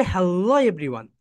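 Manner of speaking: slow "Hello", starting low and swelling on "-lo"; stretched stress on Hello. Shown as a demonstration. Teacher shows where the intro goes, stretched stress on "Hello". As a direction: swoop through "Hello", falling on "everyone". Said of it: "এইরকম ভাবে নিয়ে যাবে".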